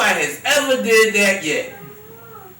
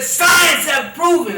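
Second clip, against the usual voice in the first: loud, slow and higher, leaning on Science; mock preachy brother; 9 semitones higher, 8 dB louder, slower than usual. Mocking the brother on Broad Street, loud slow proclamation. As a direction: boom it out like a know-it-all. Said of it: "And the brother said".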